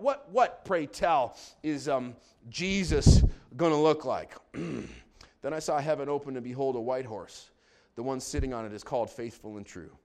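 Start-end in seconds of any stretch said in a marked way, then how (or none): 4.5-10.0 low, soft, reverent reading; hushed scripture reading. Real, not acted. Preacher reading the throne vision to congregation, hushed reverence. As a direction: read softly and low, slowing and swelling at the hymn's end.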